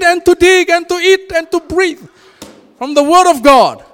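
high, loud preaching voice; fervent preacher in full flow; full-force preaching. Acted. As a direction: proclaim it to the whole hall.

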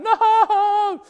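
a high, loud no with a laugh; panicked laughing shout. Retold or imitated. A man panicking at God's request, high laughing yell. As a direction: cry out in mock alarm.

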